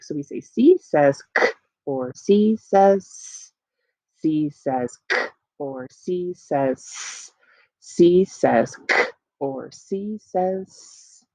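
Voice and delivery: C says k clipped, C says s drawn out slowly; k short and hard, s slow and long; letter sounds. Shown as a demonstration. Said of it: "remind ourselves of the sounds".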